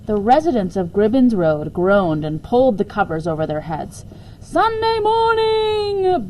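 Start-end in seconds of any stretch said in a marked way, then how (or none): 4.4-6.3 much higher, slow, stretched cry; incredulous bellow. Acted. Woken husband crying out in disbelief at dawn. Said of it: "bellowed the incredulous Mister Barraclough".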